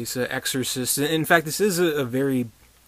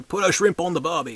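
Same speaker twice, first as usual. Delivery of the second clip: a light Aussie twang, higher; mock Australian accent; 5 semitones higher than usual. Reviewer hears the actor's Australian accent slip through, in a light mock Australian accent. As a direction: toss off a jokey Australian accent. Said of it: "we can kinda hear".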